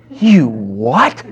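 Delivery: stunned rising you what, laughing through it; a child who can't believe it; shocked little boy. Retold or imitated. Son hearing his dad flushed it, stunned and laughing.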